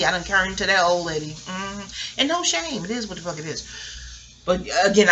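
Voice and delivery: ordinary talk, nothing marked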